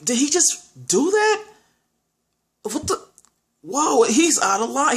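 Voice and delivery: high-pitched, incredulous reaction; retold disbelief. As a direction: replay being stunned by what was just seen. Acting out disbelief at the scene.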